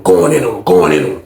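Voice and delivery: usual speaking voice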